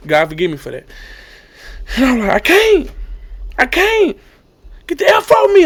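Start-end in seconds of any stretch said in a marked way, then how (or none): 2.3-5.7 high-pitched re-enacted plea; distressed refusal. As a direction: push helpers away in mock anguish. Re-enacts crying in pain, refusing help.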